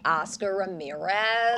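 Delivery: soft American accent, name eased out. Shown as a demonstration. Guest answering host in accent duel, soft American. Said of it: "it'll be like".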